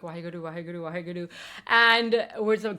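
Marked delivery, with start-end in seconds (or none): 0.0-1.3 soft, hushed chanting of a prayer; muttered prayer. Retold or imitated. Scared rider praying on the roller coaster, hushed chant. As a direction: pray under your breath, scared. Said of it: "I was just praying".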